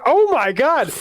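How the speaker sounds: high-pitched mock-horrified exclamation; retold shock. Podcaster retelling his reaction to the gory death, high-pitched shock.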